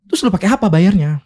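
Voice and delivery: high-pitched, baffled question; incredulous friend. Retold or imitated. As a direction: imitate a friend's astonished challenge. Teman kaget soal bayar utang. (menirukan teman yang kaget, bernada tinggi)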